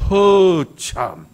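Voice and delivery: very slow, loud Chungcheong drawl; hammed-up slow Chungcheong exclamation; Chungcheong dialect. Shown as a demonstration. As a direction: stretch it right out in Chungcheong-do dialect. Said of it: "충청도 말 한마디요".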